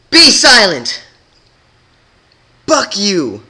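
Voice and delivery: very high, loud defiant yell; defiant shout. Acted. Rebel defying Twilight, loud defiant yell. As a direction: hurl it at her. Defiant outburst, others cheer.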